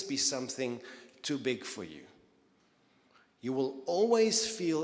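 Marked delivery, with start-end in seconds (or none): 3.4-4.8 emphatic preaching. Acted.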